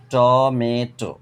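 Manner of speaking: টমেটো slow, high and loud as ট, মে, টো; split in three syllables. Shown as a demonstration. Teacher demonstrating three syllables, টমেটো slowly broken up. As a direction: stretch all three beats of টমেটো. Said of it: "তিনবারে ভেঙে উচ্চারণ করা হয়".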